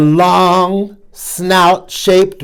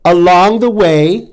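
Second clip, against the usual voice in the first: usual speaking voice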